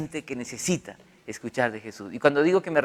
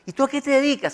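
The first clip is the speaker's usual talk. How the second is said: curious stranger; 10 semitones higher, faster than usual. Retold or imitated. Stranger asking his job.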